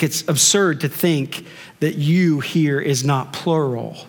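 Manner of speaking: normal talking voice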